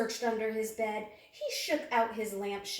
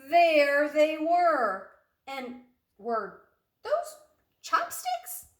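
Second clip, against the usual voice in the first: slow, loud, then squeaking high; dramatic reveal; 6 semitones higher, 9 dB louder, slower than usual. A narrator's reading. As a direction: build suspense then squeak in disbelief.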